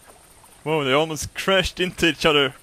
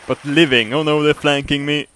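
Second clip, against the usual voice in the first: normal talking voice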